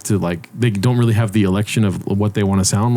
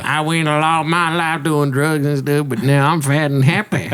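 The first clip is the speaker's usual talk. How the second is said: [name]; 4 semitones higher than usual. Retold or imitated. Co-host impersonating a singer on request, Southern accent. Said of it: "You think you speak like [name]?".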